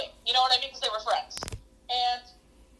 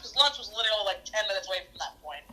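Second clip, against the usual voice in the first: normal talking voice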